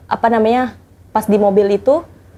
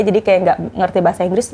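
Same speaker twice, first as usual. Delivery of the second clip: ordinary delivery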